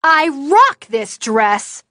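high, loud, slow defiant yell; outraged shout. Acted. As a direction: fling it back in defiance. Indignant retort to the insult.

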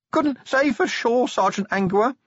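very high, strangled, frightened; terrified squeak. A narrator's reading. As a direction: squeak it out in panic. Pinned watchman squeaks answers.

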